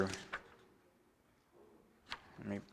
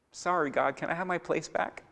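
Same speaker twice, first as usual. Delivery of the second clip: meek whining apology; 4 semitones higher, 9 dB quieter than usual. Retold or imitated. Saul's hollow apology, voiced.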